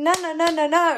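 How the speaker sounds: mock indignant no-no-no; exasperated refusal. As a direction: shut the idea down in disbelief. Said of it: "you're thinking".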